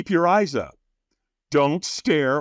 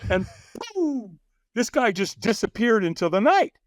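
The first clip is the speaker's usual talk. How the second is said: ordinary talk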